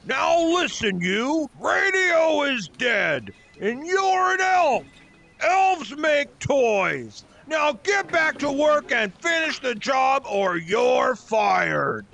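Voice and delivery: shouted, drawn-out scolding; angry boss. Acted. Boss ordering elf back to work, angry shouting. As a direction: lay down the law, stretching the key words. Boss threatens Herbie with firing.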